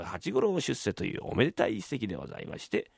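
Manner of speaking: normal talking voice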